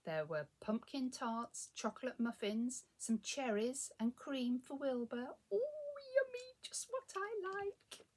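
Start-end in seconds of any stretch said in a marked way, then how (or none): 5.5-8.1 high-pitched, delighted little cat voice. A narrator's reading. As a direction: gush over a treat in a high voice. Cat's delight at the cream.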